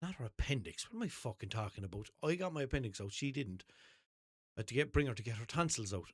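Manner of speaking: usual speaking voice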